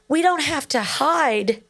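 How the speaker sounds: tearful, voice high and wavering; talking through happy tears; crying. Real, not acted. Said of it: "I'm crying".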